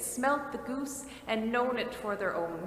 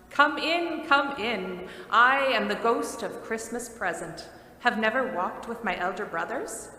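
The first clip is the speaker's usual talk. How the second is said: loud, hearty welcoming giant; Ghost of Christmas Present; 5 dB louder than usual. Acted. The giant spirit welcomes Scrooge into the room, booming and hearty. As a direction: boom out a jovial welcome from the couch.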